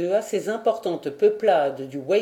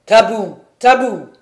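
loud ringing command; commanding shout; 2 semitones higher, 10 dB louder than usual. A narrator's reading. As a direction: stop the mob with one word. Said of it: "s'écria-t-il".

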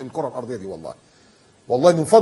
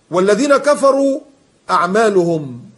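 drawn out, higher and louder chanting of the verse; slow Quran recitation; 5 semitones higher, 7 dB louder, slower than usual. Real, not acted. Preacher reciting to his congregation, slow and chanted. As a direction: stretch each word like a recited verse.

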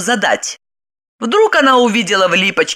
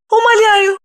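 high-pitched tearful begging; tearful pleading; 13 semitones higher than usual. A narrator's reading. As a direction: beg as if in floods of tears. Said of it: "заливаясь слезами, попросила она". (слёзная мольба высоким голосом)

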